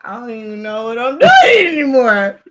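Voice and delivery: put-on cooking mom swamped by kids, laughing despair; overwhelmed outburst. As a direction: throw it out like a mom at the end of her rope. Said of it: "I'm just like".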